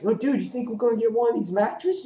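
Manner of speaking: high put-on dude voice; guard voiced high. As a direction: play the goofy guard, pitched high.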